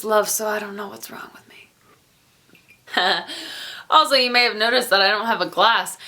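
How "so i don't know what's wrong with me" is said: fast whispered aside. Real, not acted. Self-mocking aside.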